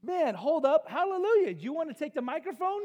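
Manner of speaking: loud, high hallelujah shout; excited. Retold or imitated. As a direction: shout it up like praise. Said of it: "And I just went like".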